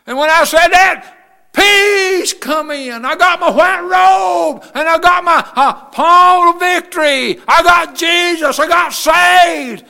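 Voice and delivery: high, drawn-out cries of triumph; exultant shouting. Real, not acted. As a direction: preach it out in full-force joy. Climax of his salvation testimony.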